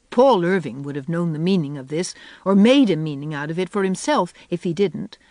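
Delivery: ordinary delivery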